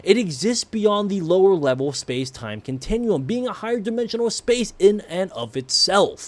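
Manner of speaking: ordinary talk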